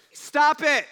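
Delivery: loud, high, sudden command; commanding shout. Retold or imitated. Jesus ordering the storm to stop, loud shout. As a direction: bark it at the storm.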